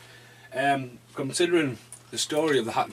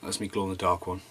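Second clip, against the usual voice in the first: ordinary talk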